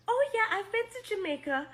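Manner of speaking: high-pitched mock eager reply; imitated typical response. Assuming outsider replies to someone from the Caribbean, high mimicking voice. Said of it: "this is a response".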